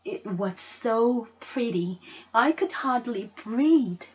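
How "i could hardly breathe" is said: usual speaking voice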